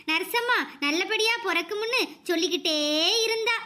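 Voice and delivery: usual speaking voice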